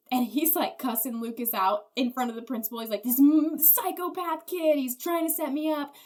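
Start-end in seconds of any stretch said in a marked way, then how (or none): 3.0-5.9 retold freak-out. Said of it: "he just freaks out".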